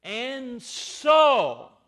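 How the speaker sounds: "And so" hit high and loud, each word weighty; stress on "And so". Shown as a demonstration. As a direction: land hard on both words, "And so". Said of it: "Paul puts the emphasis".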